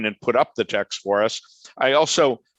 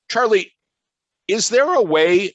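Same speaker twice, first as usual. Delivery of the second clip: calling out; 7 semitones higher, 3 dB louder than usual. Real, not acted. Calling to the screen-sharer.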